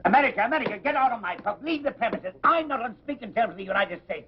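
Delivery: indignant barkeep ordering him out; angry outburst. Acted. Publican throws out an American.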